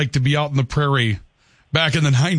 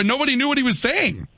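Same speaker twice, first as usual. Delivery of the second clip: normal talking voice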